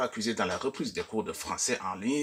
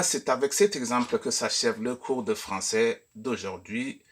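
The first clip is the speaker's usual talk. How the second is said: ordinary delivery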